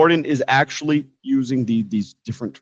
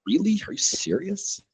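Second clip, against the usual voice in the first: high, softer incredulous question; doubting; 6 semitones higher, 6 dB quieter than usual. Shown as a demonstration. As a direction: question it as if you don't believe it. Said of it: "Sounds like you're doubting".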